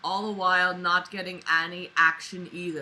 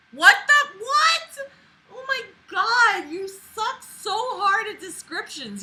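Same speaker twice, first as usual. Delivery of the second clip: high, loud outraged yell; exasperated shout; 13 semitones higher, 7 dB louder than usual. Real, not acted. Yelling at the diary writer in outrage.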